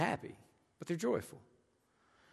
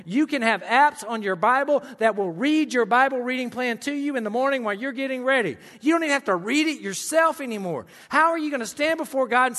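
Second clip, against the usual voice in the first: higher, louder, pressing preaching; fervent urging from the pulpit; rousing exhortation; 7 semitones higher, 6 dB louder than usual. Acted. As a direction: push them to act, no excuses.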